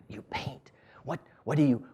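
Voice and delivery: whispered, delighted question; hushed surprise. Real, not acted. To Gertrude on the bench, a whispered amazed question.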